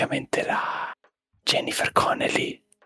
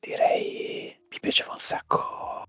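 whispering. Real, not acted. Whole ASMR podcast whispered.

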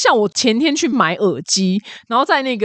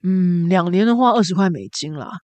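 lightly voiced jaded clerk, lower; world-weary clerk; 3 semitones lower than usual. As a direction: sell it half-heartedly, like a bored clerk. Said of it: "他说".

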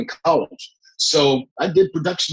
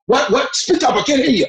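higher-pitched demanding interruption; Kathy Bates; 11 semitones higher than usual. Retold or imitated. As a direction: demand that they speak up, pitched high. Acts out Kathy demanding louder speech.

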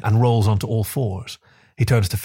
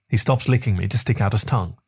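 usual speaking voice

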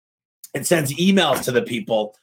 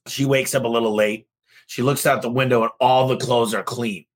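usual speaking voice

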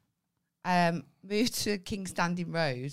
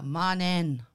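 "Morning." said low, louder, falling; low firm greeting; 6 dB louder than usual. Retold or imitated. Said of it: "Like that".

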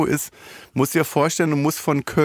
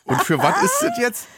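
much higher, laughing question. Real, not acted. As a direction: ask it while still laughing. Laughing question after the drops.

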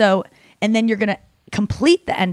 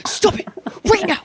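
high, pleading scold, much higher pitch; mock panicked friend; 10 semitones higher than usual. Friend begging Nikki not to reveal the code, high panicked scold. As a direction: beg Nikki to stop revealing the secret. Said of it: "she's going".

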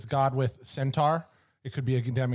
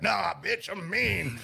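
mean husband; 5 semitones higher, much slower than usual. Retold or imitated. Zeus voiced in story.

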